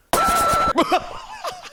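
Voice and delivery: a very high, loud burst of real laughter; uncontrollable laugh. Real, not acted. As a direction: laugh helplessly, you can't stop. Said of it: "uncontrollable laughter".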